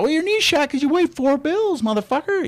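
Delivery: high, loud, incredulous ridicule; mock scornful rebuke. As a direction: tear down the excuse in a squawking pitch. Acts out scornful old self rebuking excuses.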